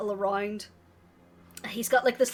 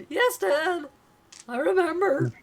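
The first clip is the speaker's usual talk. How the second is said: put-on higher, louder young boy; a dutiful little son answering; lightly voiced boy, a bit high; kid voice; 6 semitones higher, 6 dB louder than usual. Son answers father in story.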